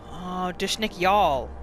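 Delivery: ordinary delivery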